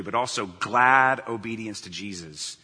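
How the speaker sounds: slow down and lean hard on "glad"; stress on "glad". Real, not acted. Preacher quoting scripture to show what obedience needs, "glad" stressed long and loud. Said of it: "Emphasis on the word glad".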